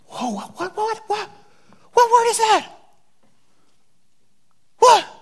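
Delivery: high laughing "what, what, what?"; astonished. Real, not acted. Preacher feigning shock at the word to the crowd. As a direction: jump up in mock shock, laughing.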